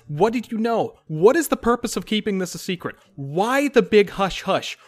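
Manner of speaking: high, loud, fast interrogation; furious demand. Acted. Dane jabs at the captain, demanding answers, loud and high. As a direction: push her to fight back. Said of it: "he says".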